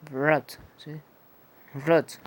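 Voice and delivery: rot with a short, dry O; English word rot, dry O. Shown as a demonstration. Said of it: "es una O más bien seca".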